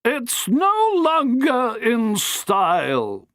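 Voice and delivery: high, slow, firm society matron. Acted. Matron dismisses the hat, firm and slow. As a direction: lay it down firmly like a grand dame. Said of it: "said Missus Banning Cannon firmly".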